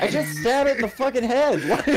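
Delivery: high-pitched through real laughter; laughing. Real, not acted. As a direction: crack up while describing the kill. Laughing while describing the finishing blow.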